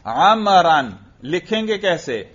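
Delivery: normal talking voice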